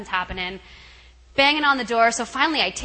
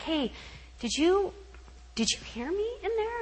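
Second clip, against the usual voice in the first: higher, softer hesitant question; sheepish, halting inquiry; tentative asking; 8 semitones higher, 7 dB quieter than usual. Retold or imitated. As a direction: ask gingerly, voice lifting. Sheepishly asks guest after escape.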